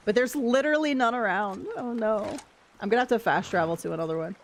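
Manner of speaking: ordinary delivery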